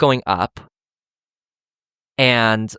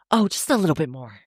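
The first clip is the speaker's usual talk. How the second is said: higher, breezy fake reassurance; lightly voiced passing hiker brushing it off; mock hiker reassurance; 7 semitones higher than usual. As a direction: imitate a hiker fibbing lightly. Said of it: "They're always gonna say".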